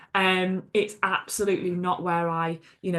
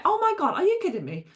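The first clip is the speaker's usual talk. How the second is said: high-pitched exasperated outburst, falling away; mock-exasperated disbelief; retold frustration; 11 semitones higher, faster than usual. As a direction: act out being fed up at an interruption. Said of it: "I was so frustrated".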